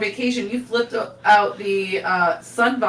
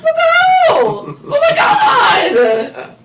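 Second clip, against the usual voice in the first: slow, high laughing shout; spider's panicked shout; 8 dB louder, much slower than usual. Retold or imitated. Voicing the shocked spider in the car story, high laughing shout. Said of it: "That spider was also like".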